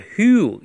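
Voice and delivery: "who" said as "hyoo" through pushed-out lips; rounded pouting lips. Shown as a demonstration. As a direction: pout into a little trumpet for "who". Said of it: "要小喇叭嘴". (撅起小喇叭嘴读"who")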